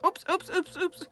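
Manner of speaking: put-on child voice. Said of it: "And he said".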